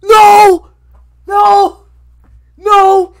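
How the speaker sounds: screaming no over and over, high and loud; desperate repeated screaming; anguished screaming. Real, not acted. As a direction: howl of real despair.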